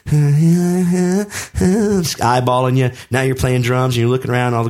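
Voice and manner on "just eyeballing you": put-on ogling girls. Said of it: "girls in the front were like".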